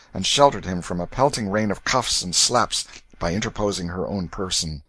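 ordinary delivery

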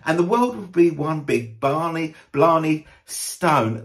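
lifted, sing-song mock reading of flowery lines; mocking lyric reading. Reviewer mocking [name]'s flowery verses, amused sing-song.

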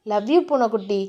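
low-pitched, lightly voiced male lover; loving boyfriend. Hero hugs his beloved close, low and loving. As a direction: murmur it low and tenderly.

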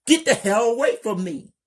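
high, loud, fast frightened scream; scared. Acted. Kelly terrified of Lena, fast high scream. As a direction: shriek it in panic, backing away.

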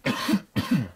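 loud harsh coughs; coughing fit. Real, not acted. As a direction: cough hard after a big pull. Coughing after the pull.

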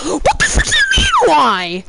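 very high cracking shout; raging gamer. Real, not acted. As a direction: shriek it in outrage. Raging at teammate.

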